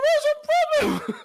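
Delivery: high squeaky voice with a laugh, slow; laughing at your own broken teen voice; arguing with the ref in a cracked squeak. Retold or imitated. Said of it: "with this squeaky voice".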